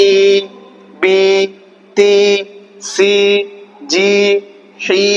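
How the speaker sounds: each of ই, বি, তি, সি, জি, হি held long on a level tone; one long, separate syllable at a time for ই, বি, তি, সি, জি, হি; slightly drawn-out vowels. Shown as a demonstration. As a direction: stretch the vowel in every syllable from ই to হি. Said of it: "এটা আমরা টানছি".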